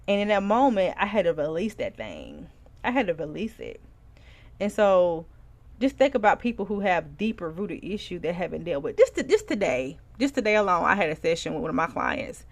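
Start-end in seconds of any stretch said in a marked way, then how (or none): none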